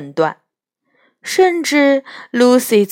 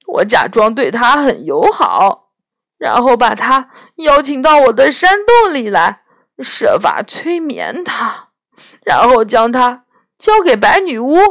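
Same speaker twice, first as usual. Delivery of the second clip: a lightly voiced tearful faun, louder; weeping faun; 3 semitones higher, 5 dB louder than usual. Faun confessing his wickedness to Lucy, tearful faun voice. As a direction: play the ashamed faun in tears.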